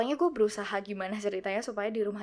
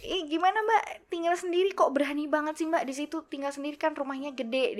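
higher, louder neighbor-lady imitation; ibu tetangga kepo; 7 semitones higher, 3 dB louder than usual. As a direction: ask in amazement like a nosy neighbor. (menirukan tetangga bertanya heran, bernada tinggi)

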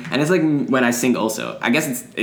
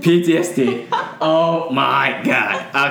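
laughing; much slower than usual. Real, not acted. Police car startles them.

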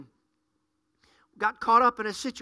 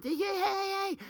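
high, sugary put-on hello; fake friendly greeting; 7 semitones higher, 6 dB quieter, slower than usual. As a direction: overdo the fake smile in your voice. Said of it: "fake face on and".

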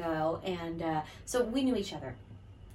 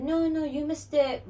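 slow mock French accent, drawn out; a playful fake French accent turning her away; bad French accent; 8 semitones higher, 3 dB louder, slower than usual. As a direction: imitate a Paris local in a hammed-up French accent. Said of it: "My bad French accent, here it is".

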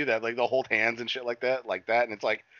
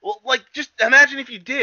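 higher, louder mock voice of the brother; lightly voiced brother, urging; imitating the brother; 13 semitones higher, 7 dB louder than usual. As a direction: coax him in a put-on higher voice. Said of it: "And then he's like".

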